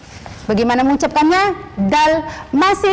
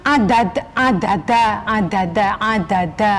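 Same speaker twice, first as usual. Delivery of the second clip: lower, heavy Javanese "ada, ada"; medok Javanese "ada"; 6 semitones lower than usual. Retold or imitated. Teacher imitates the medok "ada" students should avoid. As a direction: lightly mimic the medok "ada". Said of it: "Jangan dimedokkan".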